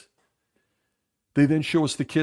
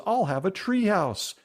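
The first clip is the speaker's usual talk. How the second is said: put-on little girl voice; 9 semitones higher than usual. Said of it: "they show says".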